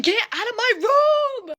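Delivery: high, loud, drawn-out mock yell; indignant yell. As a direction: imitate an outraged cousin shrieking. Cousin yelling at intruder again.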